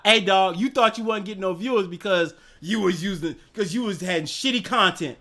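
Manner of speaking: usual speaking voice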